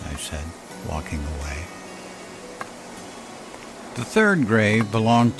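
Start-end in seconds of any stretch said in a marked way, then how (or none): none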